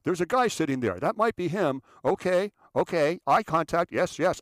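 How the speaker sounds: high, loud self-important phone talk; imitated loud cell-phone show-off; yelling into cell phone. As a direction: bark a running commentary into a phone. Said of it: "yelling at cell phones".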